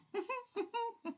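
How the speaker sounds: very high impish cackle. Acted. Little man cackles leaving the room. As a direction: cackle like a mischievous imp.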